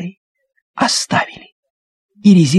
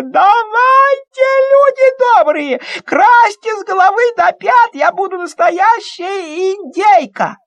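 squealing with delight; 13 semitones higher than usual. Acted. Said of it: "прямо завизжала от восторга".

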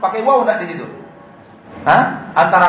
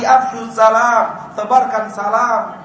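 slow, deliberate "Afshus salam"; slow quoting; 4 semitones higher, slower than usual. Shown as a demonstration. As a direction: quote the Prophet slowly, word by word. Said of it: "Kata Nabi". (kutipan pelan dan mantap)

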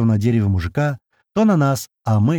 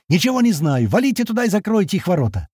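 high-pitched, agitated order; heated, insistent outburst; yelling; 8 semitones higher than usual. A narrator's reading. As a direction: shout down any objection. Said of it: "заорал".

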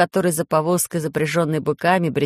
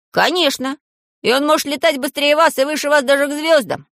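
put-on high-pitched boyish enthusiasm; keen, high young hero; eager boasting; 9 semitones higher, 3 dB louder than usual. A narrator's reading. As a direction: brag about your friend, pitched high throughout. Boy proudly defends his ship.

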